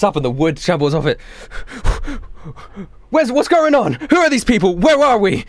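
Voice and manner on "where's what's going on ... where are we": high-pitched urgent questions; dad waking in a panic; panicked confusion. Acted. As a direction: fire off alarmed, disoriented questions. Father wakes, confused and alarmed.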